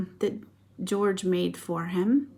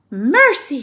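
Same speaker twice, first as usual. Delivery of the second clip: sharp loud high exclamation; mother's startled loud cry; 6 semitones higher, 12 dB louder than usual. A narrator's reading. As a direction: cry out in alarm. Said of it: "she cried".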